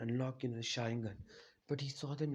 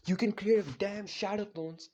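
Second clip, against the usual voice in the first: exasperated outburst; 7 semitones higher, 6 dB louder than usual. Retold or imitated. Exasperated at Hokage's excuse.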